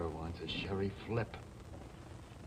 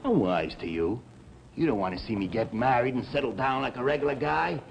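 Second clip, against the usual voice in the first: louder, bitter and cutting; furious at his friend, louder throughout; angry accusation; 5 dB louder than usual. Acted. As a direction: throw it in his face. Quarrel with Rocky.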